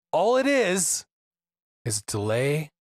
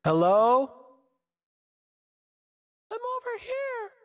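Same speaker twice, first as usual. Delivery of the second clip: distant calling. Retold or imitated. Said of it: "your one person is like".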